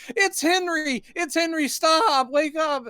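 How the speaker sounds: high-pitched imitation of frantic cries; mock panicked plea. Elizabeth tries to stop Henry strangling his friend, mock panicked plea. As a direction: plead high and urgent, hammed up.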